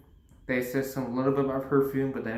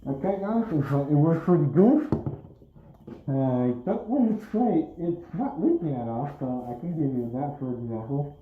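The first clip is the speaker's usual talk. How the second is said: higher, louder, muffled by a gas mask; loud through gas mask; 4 semitones higher than usual. Real, not acted. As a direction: project loudly through a respirator. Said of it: "try to speak a little louder".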